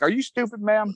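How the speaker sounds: mock disbelief, pitched well up; soldier's unspoken retort to the captain, high and incredulous; incredulous look. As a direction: imitate a silent are-you-serious stare. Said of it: "And I was looking at her like".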